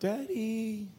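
put-on high little girl's voice calling from bed; toddler calling out. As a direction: play the three-year-old demanding from her bed. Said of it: "She's calling me from bed".

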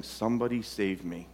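whisper-close plea for rescue; pleading quietly. Retold or imitated. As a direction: inner child begging softly. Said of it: "our inner child is saying".

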